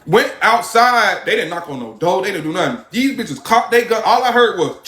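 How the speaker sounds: normal talking voice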